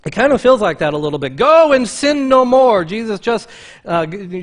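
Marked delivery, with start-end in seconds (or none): 1.4-2.8 higher, louder, slow preachy charge. Retold or imitated. Preacher showing Jesus getting preachy, louder and higher. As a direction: wag a finger as you say it. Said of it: "Was Jesus being preachy?".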